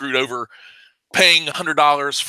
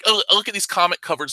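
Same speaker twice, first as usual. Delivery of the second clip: ordinary talk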